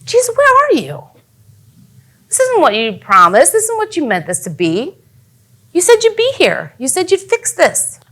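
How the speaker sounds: high, hurt complaint to Jesus; reproachful plea. Acted. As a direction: plead as if let down, pitch leaping up. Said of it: "in the middle of something and you're like".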